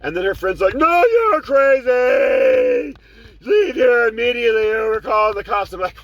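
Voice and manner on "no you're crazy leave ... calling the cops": high, very loud, drawn-out yell; mock shout. Passenger's friend ordering the driver off, high mock shout. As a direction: bark it like an outraged passenger.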